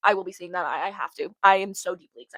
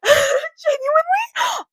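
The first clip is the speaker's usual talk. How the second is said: much higher, slow, loud wailing laugh; 9 dB louder, much slower than usual. Real, not acted. Admitting she freaks out, with a laughing wail.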